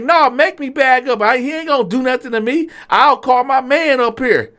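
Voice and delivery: imitated waiter's high-pitched threat; defiant dare. Waiter refusing to back up from customer, defiant dare.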